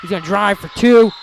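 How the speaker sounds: urgent live sports commentary; excited play-by-play. Real, not acted. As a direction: call the action as it unfolds.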